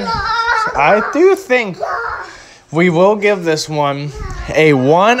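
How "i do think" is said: very high, very slow sing-song; drawn-out verdict. Pretend. Reviewer building suspense before the score, high and slow. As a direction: stretch it out like a big announcement.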